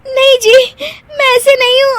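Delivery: high, loud pleading with laughter; high laughing cry; laughing plea. Acted. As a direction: beg him while giggling. Wife pleads while laughing.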